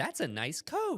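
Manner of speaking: high, slow put-on voice; overfriendly compliment. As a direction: admire it with exaggerated sweetness. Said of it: "If we were like".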